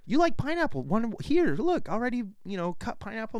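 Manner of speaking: high, sing-song coaxing voice; lightly mock the wife soothing him; coaxing wife imitation.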